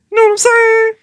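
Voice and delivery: "You know what I'm saying?" squealed high and loud. Acted. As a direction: shriek it out in glee. Repeated as a squeal.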